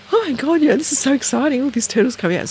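high, excited re-enactment; retold excitement. Retold excitement at turtles arriving.

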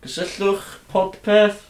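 talking through a scarf, high and slow; muffled by scarf. Real, not acted. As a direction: say it with cloth over your mouth. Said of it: "heb sgarff yn dy geg".

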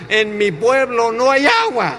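high, drawn-out protest; exasperated punchline. Retold or imitated. Villager baffled by talk of pools, high exasperated protest. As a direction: wail it slowly, pitch climbing.

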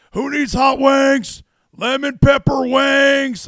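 high, slow, shouted hot-wing pitch; a wrestler hawking wings at full yell; Stone Cold impression. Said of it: "if Stone Cold Steve Austin was trying to, like, sell hot wings".

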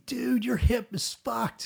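imitating a thrilled doctor, high, loud and drawn out; excited. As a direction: blurt it in high-pitched amazement. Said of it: "he's, like, excited about it".

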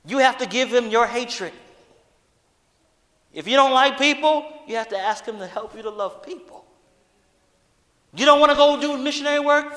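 loud, high-pitched sermon at full force; fiery preaching. Acted. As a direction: preach it hard to the congregation.

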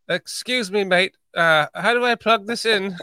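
imitating a British visitor; polite mock-British question; mock British accent. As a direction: play a puzzled Brit with a put-on British accent. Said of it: "it's gonna be like".

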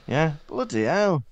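ordinary talk, nothing marked

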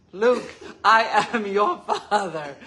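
a light laughing Vader quote; Darth Vader line. Retold or imitated. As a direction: drag it out like a movie villain. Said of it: "Just say".

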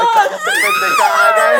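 high, loud teasing yell; playful shout. Real, not acted. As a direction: shout her down, laughing. Friend teases her about song.

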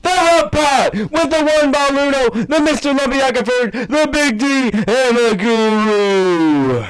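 very high, loud, drawn-out introductions; ringmaster. Acted. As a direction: bellow each name like a ringmaster. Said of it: "Ladies and gentlemen".

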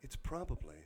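low, soft, read-aloud narration; audiobook narration. Acted. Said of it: "This is turning into an audiobook now".

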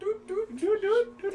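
high, light little doots; playful doot-doot sounds. Acted. Man taking his shirt off in the stall, carefree high doots. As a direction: toot out high notes for a carefree undressing. Mimes leisurely undressing with doots.

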